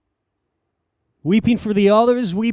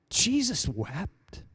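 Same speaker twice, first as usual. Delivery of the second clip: slow, soft, grieving hush; solemn hush; 8 dB quieter, much slower than usual. Acted. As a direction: let it fall slowly and softly. Solemn climax of the story.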